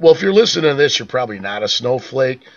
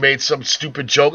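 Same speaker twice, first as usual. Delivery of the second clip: ordinary talk, nothing marked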